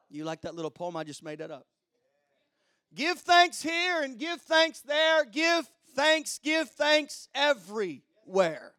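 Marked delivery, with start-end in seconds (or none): 2.9-8.7 high, driving pulpit chant; rallying sermon refrain, pitched up; rousing preaching. Real, not acted. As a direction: preach the rhyme out in a raised voice. Repeats rhyme, preaching it harder.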